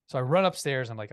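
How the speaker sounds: ordinary delivery, nothing marked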